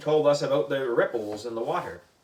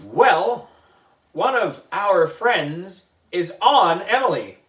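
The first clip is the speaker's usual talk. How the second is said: louder, teasing; loud teasing announcement; smug; 4 semitones higher, 6 dB louder than usual. Acted. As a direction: point it out with glee. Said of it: "said Andrea".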